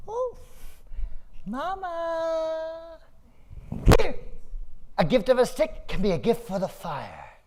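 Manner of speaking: put-on high child voice; eager little boy. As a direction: offer it brightly to mama. Boy offers stick.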